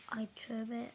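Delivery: whispered "Ay tövbe" under the breath; low shocked whisper. Real, not acted. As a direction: whisper it, scandalised. Aside while unpacking odd items.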